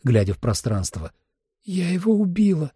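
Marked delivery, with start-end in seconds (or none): 1.6-2.7 high, stricken voice, lightly acted; shocked confession. Holly believes she killed him, high stricken voice. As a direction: confess it with understated shock, pitched up.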